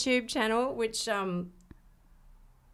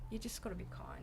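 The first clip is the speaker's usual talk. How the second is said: whisper; 15 dB quieter, faster than usual. Real, not acted. Heartfelt advice, dropping to a whisper.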